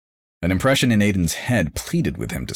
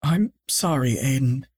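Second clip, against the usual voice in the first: sad mother; 9 semitones higher than usual. A narrator's reading. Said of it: "his mother".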